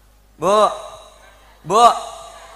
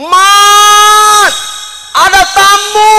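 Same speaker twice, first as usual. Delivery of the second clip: very high, loud yell across the street; wife yelling; 12 semitones higher, 10 dB louder, much slower than usual. Acted. Wife calling husband at the coffee stall, loud yell. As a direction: shout the husband's name to call him home. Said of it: "bini teriak-teriakan".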